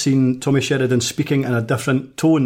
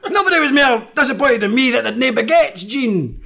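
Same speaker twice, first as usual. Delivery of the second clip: Tommy Sheridan impression; 10 semitones higher than usual. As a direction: mimic his booming orator tone. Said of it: "Just, just speak normal".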